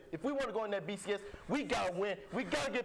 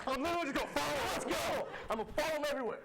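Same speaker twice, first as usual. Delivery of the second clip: excited impression; 7 semitones higher, faster than usual. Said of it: "I'm gonna be Jackson Jeffco now".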